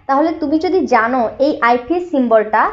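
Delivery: usual speaking voice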